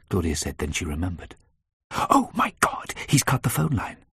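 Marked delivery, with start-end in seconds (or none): 1.9-4.0 high, breathy, slow gasp of dread; horrified realisation. A narrator's reading. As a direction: gasp as it dawns on you. Claudia realises they are cut off.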